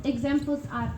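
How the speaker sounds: usual speaking voice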